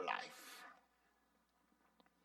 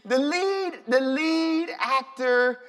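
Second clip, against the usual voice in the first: much louder and higher, revving up; getting into character; 6 semitones higher, 8 dB louder, slower than usual. Real, not acted. Preacher warming up to act the monologue, loud. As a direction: launch in big and loud. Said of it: "let me get into character".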